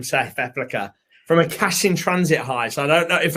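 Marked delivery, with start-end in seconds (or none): 0.0-0.9 lightly put-on South African accent; a hint of a South African accent. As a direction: slip into South African vowels for the name. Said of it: "your South African accent".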